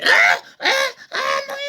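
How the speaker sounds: loud hoarse shriek of fright rising sharply; a startled shriek, high and loud; sudden scream. Retold or imitated. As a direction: scream a jump scare into someone's ear. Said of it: "il a fait".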